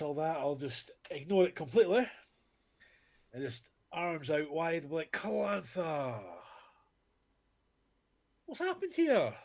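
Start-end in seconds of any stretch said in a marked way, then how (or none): 5.1-9.3 high, drawn-out confident call; breezy confidence. Acted. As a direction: call out grandly as you stroll in. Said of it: "walking out, full of confidence".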